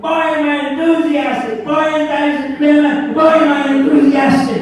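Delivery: high, loud, hyped-up motivational rallying; Carl Luther. Retold or imitated. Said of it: "You want to be enthusiastic".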